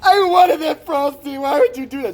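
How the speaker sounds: loud, high, tearful whine; mock near-crying wail. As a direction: wail slowly like a heartbroken big baby. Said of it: "He cried over it almost".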